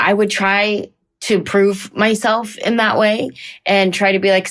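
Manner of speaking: ordinary talk, nothing marked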